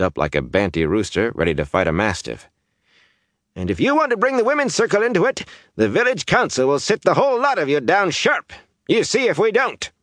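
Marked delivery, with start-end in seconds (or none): 3.5-9.9 put-on very high, cranky old man; feisty high-pitched bluster; blustering threat. A narrator's reading. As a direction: puff yourself up and threaten her. Thatcher rounds on Days, puffed up.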